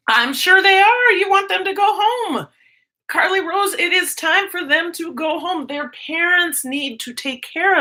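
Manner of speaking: high, emphatic sympathy; high sympathetic. Real, not acted. Teacher telling another teacher the kids must go home, high. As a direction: pitch up and commiserate.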